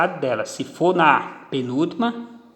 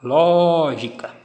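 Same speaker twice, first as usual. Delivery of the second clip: Lógica slow, LÓ held long and strong; stress on first syllable; 4 dB louder, much slower than usual. Shown as a demonstration. Teacher demonstrating antepenultimate stress, Lógica with a drawn-out LÓ. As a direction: punch and stretch LÓ in Lógica. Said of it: "antepenúltima".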